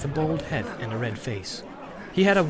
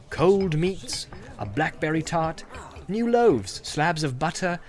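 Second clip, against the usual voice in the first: ordinary delivery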